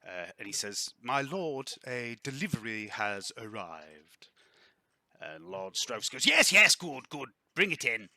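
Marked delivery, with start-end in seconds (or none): 1.0-4.3 slow, formal servant announcing; lightly voiced servant announcing a delivery. Game master voices the servant. 6.3-8.1 loud, high burst, then settling; eager lord ordering it in; impatient lord. Acted. Impatient lord answers his servant.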